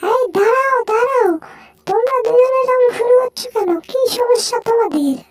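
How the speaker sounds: high, excited cartoon mouse, squealing and fussing; squeaky mouse voice. Mouse finding food then scolding the sleepy cat, high squeaky voice. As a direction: play a tiny panicky mouse, high up.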